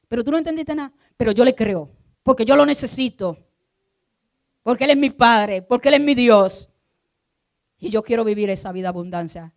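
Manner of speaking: mucho más alto y fuerte, proclamando; grítalo como el clímax del sermón; clímax de predicación. Acted. Preaching climax. (el pastor proclama su fe a toda voz)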